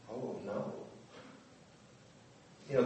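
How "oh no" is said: soft, sinking "Oh, no"; quiet dismay. Retold or imitated. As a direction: let it drop into dread.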